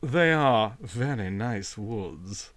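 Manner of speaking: put-on wild boy condescending to the landowner about his woods; touch of patronage. A narrator's reading. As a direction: talk down to him gently. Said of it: "with a touch of patronage in his voice".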